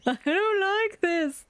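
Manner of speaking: squeaky high pleading animal voice; voiced pet. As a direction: plead in a tiny high helpless voice. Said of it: "He's like".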